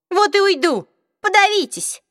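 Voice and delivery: high, loud indignant snap; offended outburst. A narrator's reading. Offended Isabel storming out on Simon and Jace, indignant and loud. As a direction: fling it at them as you leave.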